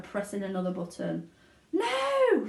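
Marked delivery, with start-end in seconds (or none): 1.7-2.5 sharp high shout. Acted. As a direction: yell it to stop him. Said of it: "shouted Charlie".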